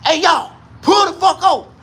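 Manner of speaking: high, loud barking trooper; mock cop shouting. Highway patrol ordering the FedEx truck over, high mock shout. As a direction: yell at them to pull over. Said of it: "they was like".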